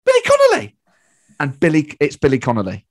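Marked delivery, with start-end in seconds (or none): 0.0-0.8 loud, high-pitched shout to a passer-by; calling out. Retold or imitated. Fan approaching a famous comedian in the street, calling out. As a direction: blurt it out across the street. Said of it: "just approaches them".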